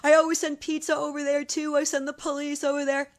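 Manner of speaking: put-on the teenage bully boasts about her pranks; bragging bully girl. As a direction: gloat like a teen showing off a cruel prank. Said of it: "She goes".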